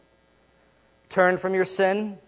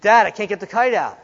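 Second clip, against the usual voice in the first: put-on higher, louder frustrated kid; hammed-up frustrated child; boy's whine; 5 semitones higher, 6 dB louder than usual. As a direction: whine it like a stuck little boy. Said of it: "It's like".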